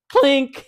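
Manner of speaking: high, loud metallic clink sound; ping it out like a spring snapping loose; sudden loud imitated clink; sharp clink. Spring flies out mid-story.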